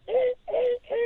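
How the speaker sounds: high, thin mock cackle of a psychopath; hammed-up crazed giggle; mock manic cackle. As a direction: cackle like a gleeful maniac. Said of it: "a psychopath is like".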